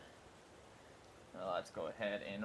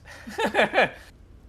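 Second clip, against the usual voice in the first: high loud laugh at herself; burst of laughter; 13 semitones higher, 9 dB louder than usual. Real, not acted. Laughing at own math.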